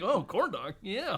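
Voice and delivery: put-on high-pitched childlike delight; like a child. Man delighted by a corn dog offer, like a child. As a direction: imitate a kid thrilled by a treat. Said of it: "he said it like a child".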